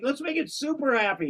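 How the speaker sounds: high-pitched mock glee; acted eagerness in a high voice; super happy. Voicing the shaky urge for a happy ending, in mock glee. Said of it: "Oh, this is a happy ending".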